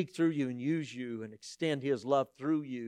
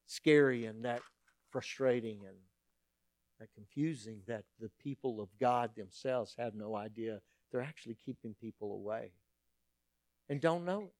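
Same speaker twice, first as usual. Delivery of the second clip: ordinary talk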